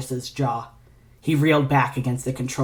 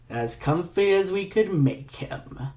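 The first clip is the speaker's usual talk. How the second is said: slow gloat; 5 semitones higher, slower than usual. Acted. Villain gloating slowly.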